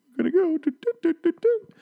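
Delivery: high cartoon doot doot tiptoe noises; sneaking doots. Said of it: "He's like".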